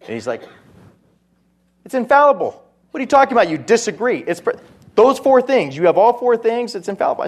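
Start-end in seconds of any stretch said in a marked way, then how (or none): 1.8-7.2 higher, louder incredulous retort; indignant protest. Retold or imitated. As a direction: imitate someone outraged at being contradicted. Player argues back in retold debate.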